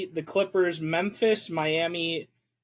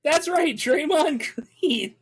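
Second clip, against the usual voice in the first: mock announcer; 9 semitones higher than usual. Real, not acted. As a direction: announce it like a game show host. Mock announcing correct answer.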